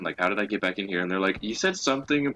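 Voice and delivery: ordinary delivery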